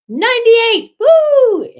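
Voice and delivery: high, loud, slowed cheer; celebrating nearly reaching video one hundred; proud whoop. Real, not acted. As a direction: shout out the milestone.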